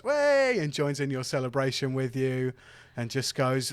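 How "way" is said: high whoop of joy; Zeed cheering with Vixen. Acted. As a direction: join the celebration. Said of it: "looks over to you, Vixen, and goes".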